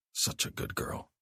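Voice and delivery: put-on slow, whispered "Such a good girl"; whispered praise. Mark whispers praise to Abby, slow and low. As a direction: breathe the praise to her.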